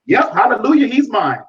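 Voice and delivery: mock eager convert. Quoting eager new believers.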